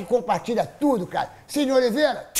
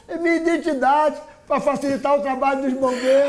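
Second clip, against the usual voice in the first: lightly voiced guy, joking; answering deadpan as the guy; quoting a guy; 5 semitones higher than usual. Retold joke, man answering. (imitando o cara)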